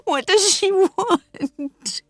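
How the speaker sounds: high, slow tearful voice; distraught mother breaking down; crying. Acted. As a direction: plead through tears, slowly. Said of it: "starts to cry".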